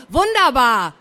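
loud, confident answer; eager classroom call. A narrator's reading. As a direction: blurt out the answer. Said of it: "rief er".

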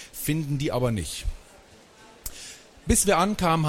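usual speaking voice

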